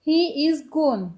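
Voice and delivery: He is gone, each word slow and distinct; very slow demo. Shown as a demonstration. Teacher slowly saying the English answer for students. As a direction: model it slowly for the class. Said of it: "इसको अब हम English में कैसे बोल सकते हैं".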